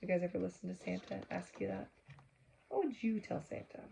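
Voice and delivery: usual speaking voice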